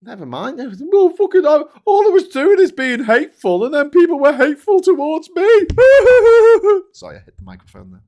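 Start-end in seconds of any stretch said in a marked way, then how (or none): 0.9-5.4 very high, loud squealing parody of the author; mocking shriek. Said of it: "And then she complains about it". 5.5-6.9 very high, loud squealing laugh in the mock voice; shrieking laugh.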